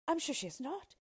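high-pitched, disbelieving princess; storyteller's high princess in denial; protesting princess. Acted. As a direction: protest that it cannot be so. Princess denies the promise.

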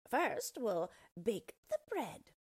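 a scheming witch, slowly. A narrator's reading. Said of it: "said the witch".

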